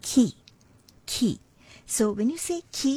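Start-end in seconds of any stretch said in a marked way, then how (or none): 0.1-1.3 ki, aspirated kh with a breath. Shown as a demonstration. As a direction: say ki with a puff of air after the k. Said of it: "you can pronounce it like this".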